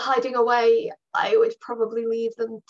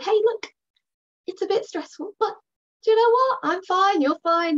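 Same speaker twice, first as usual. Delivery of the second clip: put-on higher, cheerful kids-presenter reassurance; upbeat reassurance; 7 semitones higher than usual. Shown as a demonstration. Owner telling a startled dog it's fine, upbeat and high. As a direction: brush the scare off brightly. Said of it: "worked through it together".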